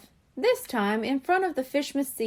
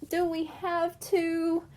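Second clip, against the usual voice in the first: whining; 6 semitones higher, slower than usual. A narrator's reading. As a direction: plead lazily, stretched out. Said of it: "Angel whined".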